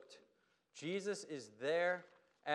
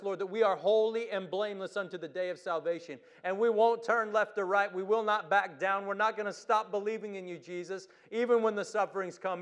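loud, rousing declarations; rally the congregation, full voice; rally speech; 4 semitones higher, 5 dB louder than usual. Acted. Rising declarations in prayer.

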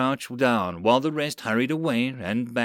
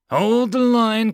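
high, loud, drawn-out battle cry; sudden yell; 10 semitones higher, 7 dB louder, slower than usual. Acted. Lieutenant rallying marines against goblins, sudden yell. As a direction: yell the order, stretching the words. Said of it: "Karath yelled suddenly".

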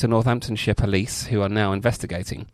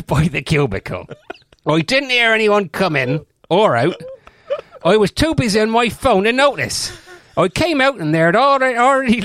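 hammed-up West Country accent, pitched higher; mock Cornish accent; 10 semitones higher, 4 dB louder than usual. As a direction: put on a jokey Cornish yokel accent while reading the quote. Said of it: "That was kind of Cornwall slash just general off".

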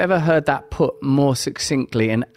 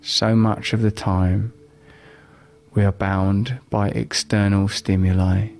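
calm, unhurried guiding voice; 3 semitones lower than usual. Acted. Leads a live guided meditation.

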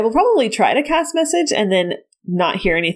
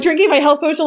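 usual speaking voice

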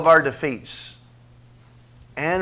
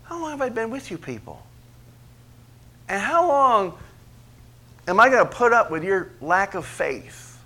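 exasperated, much higher pleading complaint; frustrated; 6 semitones higher than usual. Acted. Jesus complaining to his disciples, exasperated. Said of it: "he is frustrated".

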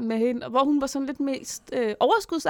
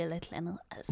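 soft whispered aside; 5 semitones lower, 12 dB quieter, much faster than usual. Real, not acted. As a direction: drop to a whisper. Whispered aside about the plane.

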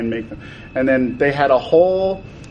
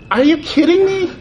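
incredulous, much higher pitched outburst; disbelief; 4 dB louder than usual. Real, not acted. Designer venting at executives, incredulous and high. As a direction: fling the question up high in disbelief. Ranting about toy executives' limits.

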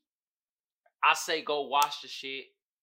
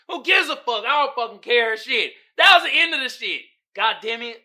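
louder, higher dismissive rant; exasperated shout; 7 semitones higher, 13 dB louder than usual. Real, not acted. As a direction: vent it loudly like you're fed up. Ranting about the ending.

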